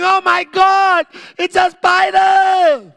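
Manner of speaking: high, loud, stretched-out hysterical scream. Acted. Mother screams while whacking the spider, hysterical screaming. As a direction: shriek it out like a panicked mother. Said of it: "the spider while screaming".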